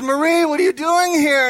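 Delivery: high, acted amazement; astonished greeting. Boudreaux spotting his wife in heaven, astonished. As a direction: blurt it out in happy surprise.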